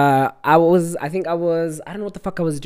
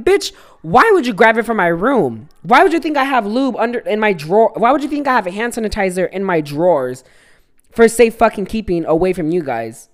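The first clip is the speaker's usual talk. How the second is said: raised, higher-pitched mock exasperation; retold indignant comeback; 5 semitones higher, 4 dB louder than usual. Arguing back at mom over the drawer, loud indignant retelling. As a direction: fire back in hammed-up indignation.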